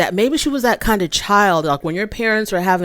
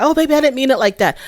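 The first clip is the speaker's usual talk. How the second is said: mock sweet apology; 7 semitones higher, faster than usual. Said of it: "he apologized".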